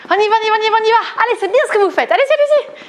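high, pushing encouragement; cheering on. Real, not acted. Fitness coach rallying viewers through fast steps, high and eager. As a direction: keep them moving, hype it.

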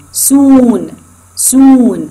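"Soon" with a drawn-out long oo, said high and loud; long vowel demo. Shown as a demonstration. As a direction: stretch the oo in "soon" to show a long syllable. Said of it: "This is also one syllable". ("soon" بصوت oo طويل وممدود، عالي وقوي)

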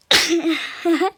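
loud real sneeze; big sneeze bursts out; sneezing. Real, not acted. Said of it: "Şimdi üst üste hapşuracağım". (yüksek sesle hapşırma)

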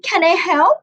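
a hint of a helpful grown-up; helpful librarian. A narrator's reading. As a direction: offer help with a lifted storybook voice. Librarian's question voiced in story.

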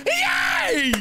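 yelling you did it in a squeaky, teary cheer. Pretend. Cheering the ragdoll Ross on, high-pitched mock shout.

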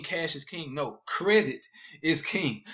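normal talking voice

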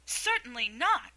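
loud, high, offended; indignant. Acted. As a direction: protest sharply and loud. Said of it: "said Alice indignantly".

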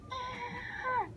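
high, short mock yelp; mock scream. Gamer mimicking the thief's cry, high and short. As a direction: squeak out a little fake cry. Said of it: "It's like".

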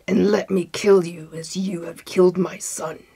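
menacing, enraged genius; terrible voice. A narrator's reading. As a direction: pronounce a death sentence in a terrible voice. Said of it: "he cried in a terrible voice".

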